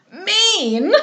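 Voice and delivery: high, loud mocking retort; incredulous captain. A narrator's reading. Captain scoffing at Lovell's demand, high and loud. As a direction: toss the word back in loud disbelief.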